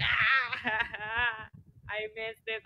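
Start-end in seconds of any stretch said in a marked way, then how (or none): 0.0-1.5 bawling acted "ah" with laughter; mock crying. Showing how she burst into tears, mock bawling. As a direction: fake a broken, bawling cry.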